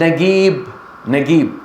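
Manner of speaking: نجيب dictated as "nagiib"; hard g in the middle of نجيب; ambiguous hard g. Shown as a demonstration. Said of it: "تقول: اكتب نجيب".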